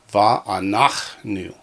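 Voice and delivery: "Va'anachnu" slowly, stressing NACH; weight on the middle NACH of Va'anachnu; accent on nach. Shown as a demonstration. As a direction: sound out va-a-NACH-nu. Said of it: "it was on the nach".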